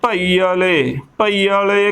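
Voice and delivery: ਭਈ ਵਾਲੇ as pa-ee-a-le, extra a before le; mispronunciation demo. Shown as a demonstration. Teacher showing what not to say, mispronounced ਭਈ ਵਾਲੇ. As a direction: say ਭਈ ਵਾਲੇ with an added vowel after the ee. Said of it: "ਗਲਤ ਉਚਾਰਨ ਹੈ".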